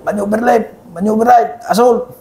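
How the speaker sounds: high-pitched drill command callouts; calling out commands. Acted. Team leader giving orders in an operation, calling out commands.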